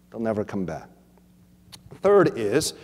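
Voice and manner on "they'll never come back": quieter, lower repeat. Shown as a demonstration.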